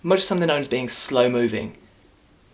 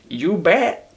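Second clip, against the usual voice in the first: imitating old man; 6 semitones higher, 4 dB louder than usual. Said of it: "doesn't make sense the way he says it".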